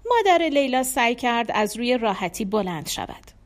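ordinary delivery

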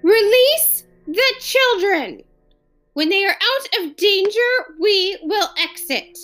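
high alien voice, forced through teeth; through clenched teeth. Acted. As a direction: bite out the demand in anger. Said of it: "Through clenched teeth".

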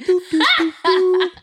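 big burst of laughter; loud laugh. Real, not acted. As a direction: crack up loudly. Cracking up at partner.

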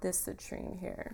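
hushed, unvoiced spa whisper; soft spa whisper. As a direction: whisper it like a calm healer. Healer handing stone, whispered.

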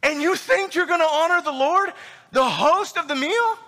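high-pitched, indignant question; incredulous Paul. Acted. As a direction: challenge them in appalled disbelief. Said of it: "feel what Paul's feeling right now".

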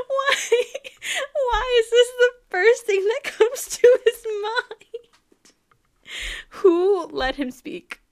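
high, loud, slow laughing outcry; laughing disbelief. Real, not acted. She laughs at Percy's lie in a high, loud outcry. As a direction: cry out through laughter in disbelief.

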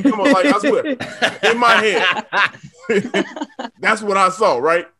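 usual speaking voice

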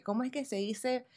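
quiet slow search for a lost word; frustrated self-muttering. Retold or imitated. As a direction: mutter it to yourself while searching your memory. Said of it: "me desespera a veces".

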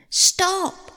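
high-pitched call to halt; urgent cry. A narrator's reading. Hermes flying in to stop her eating, urgent cry. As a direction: cut in urgently, pitch leaping up. Hermes interrupts at last moment.

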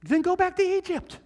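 high, fed-up dismissal; exasperated God. Pretend. God dismissing the complaining Israelites, high exasperated. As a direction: tell them off like an impatient parent. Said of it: "what I'm expecting God to tell them".

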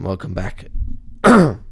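ordinary talk

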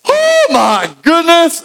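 high, slow gasp of wonder; awestruck. Retold or imitated. Peter amazed at the catch, high and slow. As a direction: drag out the amazement. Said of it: "one guy speaking up like".

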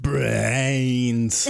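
hammed-up zombie groan on brains; English zombie moan. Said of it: "Maybe an English zombie impression".

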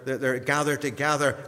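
ordinary talk, nothing marked

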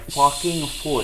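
slow, drawn-out mock-Irish; Irish accent. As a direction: stretch it out in an imitated Irish accent. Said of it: "because the way he said".

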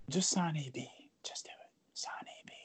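secretive hushed whisper. Pretend. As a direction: lean in and breathe it like a secret. Conspiratorial plea to the owner.

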